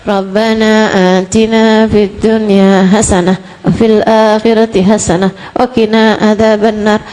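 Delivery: low, monotone droning recitation; mock listless Arabic supplication; half-hearted rote prayer. Shown as a demonstration. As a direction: recite the prayer mechanically on one note. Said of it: "Kalau kamu doanya".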